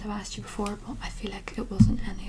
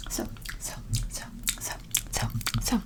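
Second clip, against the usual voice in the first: tingly asmr mouth sounds; 11 semitones lower than usual. Acted. Repeated trigger sounds.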